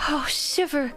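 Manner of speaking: high, slow, laughing pitiful shiver; shivering child voice. Pretend. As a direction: act freezing, whimpering. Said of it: "I'll be like".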